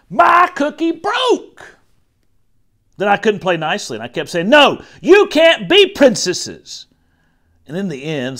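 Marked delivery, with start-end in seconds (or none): 0.1-1.8 high, loud, drawn-out wail; distraught outcry. A narrator's reading. Child's upset over broken cookie. 4.5-6.9 high, loud, stretched words; bossy child's refusal. A narrator's reading. Child refusing during play quarrel.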